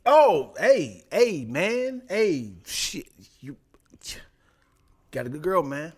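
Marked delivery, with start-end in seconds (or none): 0.0-3.6 flustered. Retold or imitated. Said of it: "I was like".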